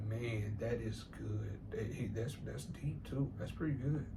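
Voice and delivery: quietly impressed. Retold or imitated. Retold woman's reaction to poem.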